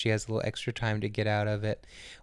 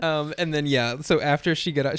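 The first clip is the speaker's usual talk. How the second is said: normal talking voice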